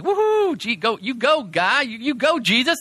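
high-pitched pretend fan whoop; mock cheering. Disciples imagined applauding Jesus after the miracle, mock cheering. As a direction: cheer it like a goofy hype squad.

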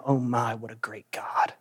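hushed, unvoiced awe at God; a whispered "what a great God"; awed whisper. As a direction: breathe it out in wonder. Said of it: "leave saying".

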